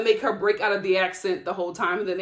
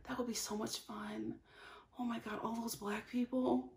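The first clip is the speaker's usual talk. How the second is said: much softer, dreamy aside; softly fantasizing about the block party; dreamy hush; 12 dB quieter than usual. Real, not acted. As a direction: drift into a private daydream. Daydreaming about finding a husband.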